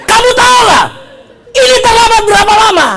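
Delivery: high, loud, hammed-up teacher's rebuke; angry scolding. Teacher to late student, scolding angrily. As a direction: berate the latecomer at high pitch.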